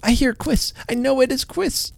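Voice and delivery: put-on high, eager little boy's cry; a thrilled boy hearing Santa outside; excited child. Boy to sister, high and excited.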